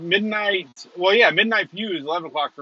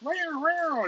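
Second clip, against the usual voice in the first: high wailing siren noise; imitated police siren; 12 semitones higher, 6 dB quieter than usual. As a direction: mimic a siren, swooping up high.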